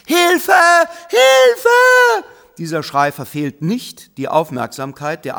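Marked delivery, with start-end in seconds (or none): 0.0-2.3 shrill, piercing cry for help, louder the second time; high full-throated scream of a little girl. Acted. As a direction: scream it out so the whole street turns. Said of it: "sie beginnt zu schreien".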